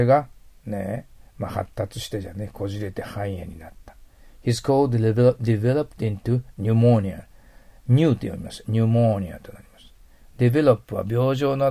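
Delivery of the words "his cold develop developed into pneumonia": slow model reading. Shown as a demonstration.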